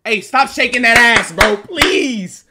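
high, loud call to stop; loud protest. Real, not acted. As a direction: yell it out, pleading at the end. Calling out at someone shaking.

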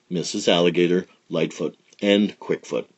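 plain reading voice

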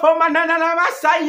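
loud bursts of tongue syllables; speaking in tongues. Real, not acted. Preacher pleading with listeners, bursting into loud tongues. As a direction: break into tongues at the height of the plea. Tongues again mid-plea.

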